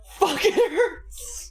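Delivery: high, laughing squeal; cracking up. Real, not acted. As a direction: drag it out high through laughter. Cracking up reading options aloud.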